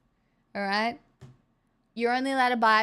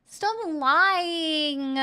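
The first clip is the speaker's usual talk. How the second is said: higher mock American accent; playful mock American accent; 5 semitones higher than usual. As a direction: needle them in a put-on American accent. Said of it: "Y'all cram so many extra syllables".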